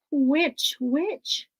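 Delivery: ordinary delivery, nothing marked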